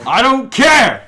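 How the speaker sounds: high, loud playful protest. Real, not acted. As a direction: shout it back, defiant and playful. Defending a pumpkin spice craving.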